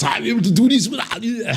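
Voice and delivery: high put-on action-star impression; Schwarzenegger. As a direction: do a hammed-up Arnold. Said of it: "I also have Schwarzenegger in me".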